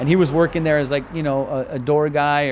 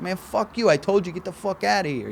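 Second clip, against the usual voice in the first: Boston doorman; 3 semitones higher, 3 dB quieter than usual. Retold or imitated. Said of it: "he was, like, this Boston guy".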